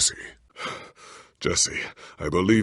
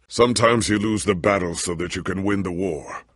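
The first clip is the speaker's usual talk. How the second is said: normal talking voice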